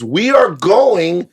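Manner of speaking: slow drawn-out loud hype, rising high; excited. Real, not acted. Host announcing the Comic-Con trip to listeners, hyped shout. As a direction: build it into a cheer.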